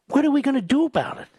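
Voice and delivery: frustrated rapid rhetorical question; exasperated outburst. Real, not acted. Rhetorical question asked twice.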